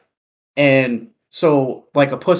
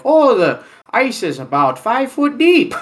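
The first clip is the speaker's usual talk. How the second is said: high, louder Wisconsin coworker impression; mimicked older local; 10 semitones higher, 5 dB louder than usual. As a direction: imitate a casual local, pitched well up. Imitates local coworker on the ice.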